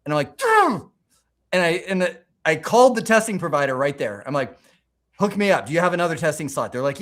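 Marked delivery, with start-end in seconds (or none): 0.4-0.9 very high, loud "ugh" of exasperation; frustrated groan. Retold or imitated. Missed exam by ten points. 5.2-6.7 higher, fast, urgent request; eager rush. Retold or imitated. Calling testing provider after failing.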